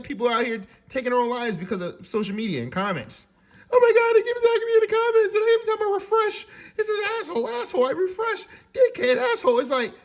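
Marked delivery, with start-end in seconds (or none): 3.7-9.6 high, mocking whine of a hurt poster; mock distress, much higher and louder at the start; whiny victim. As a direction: ham up a wounded complainer.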